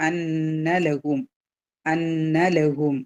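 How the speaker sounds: അന്നലഹും slowly, with a long nasal double n; two-count nasal hold. Shown as a demonstration. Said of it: "രണ്ട് count മണിച്ചിട്ട് pronounce ചെയ്യുക".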